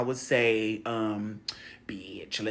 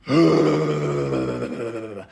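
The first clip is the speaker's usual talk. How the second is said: loud, rippling blown-out breath; long shuddering breath; 3 semitones lower, 5 dB louder than usual. Acted. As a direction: let the breath rattle out at length. Acts release while tapping foot.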